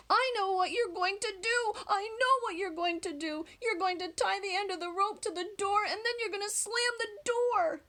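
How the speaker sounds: put-on a clever little boy; child's voice. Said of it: "Andrew said".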